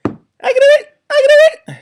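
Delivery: very high, fast little squeak; piping eager squeak of a tiny bird; tiny hummingbird voice. Acted. Hummingbird eagerly offering help, tiny squeaky voice.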